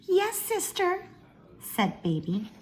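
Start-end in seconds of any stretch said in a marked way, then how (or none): 0.1-1.1 much higher, meek little sister. Acted. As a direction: pipe up high like a meek younger sister. Baby voiced in read-aloud.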